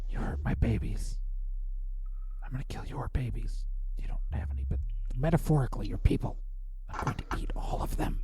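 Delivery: hushed, hissing snake mother threatens; whispering snake voice. Acted. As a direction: whisper it like a venomous serpent.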